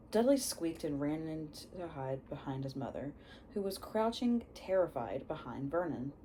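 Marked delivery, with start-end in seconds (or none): none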